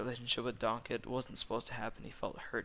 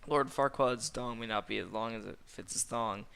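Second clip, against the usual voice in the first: plain reading voice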